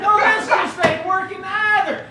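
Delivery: high, loud complaint; exasperated. Acted. Husband gives up on fake confession, exasperated.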